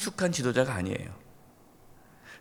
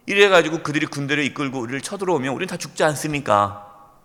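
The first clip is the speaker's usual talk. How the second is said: usual speaking voice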